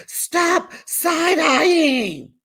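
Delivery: high-pitched, long drawn-out yell; mock holler. Dorit scolding Erica over a look, hammed-up high holler. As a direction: holler it way up high, stretching each word. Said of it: "Dorit hollers at Erica".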